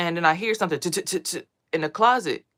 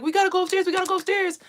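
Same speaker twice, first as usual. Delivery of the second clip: much higher, louder urgent cry; panicked urging; 4 dB louder, faster than usual. Retold or imitated. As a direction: hurry everyone upstairs, voice pitched way up. Crowd fleeing a dream hurricane.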